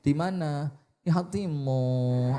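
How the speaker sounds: slow, coy phone sweet-talk; coyly lilting. Shown as a demonstration. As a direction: draw it out like flirting with a husband. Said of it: "Maka rodok ngelembeh didik". (mendayu manja, agak ngelembeh)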